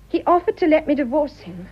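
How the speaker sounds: ordinary delivery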